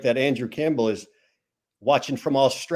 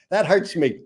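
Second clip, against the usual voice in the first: ordinary delivery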